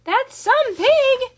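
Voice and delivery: a crowd roaring with joy; crowd cheering. A narrator's reading. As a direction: fairgoers yelling for the pig. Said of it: "yelled the crowd".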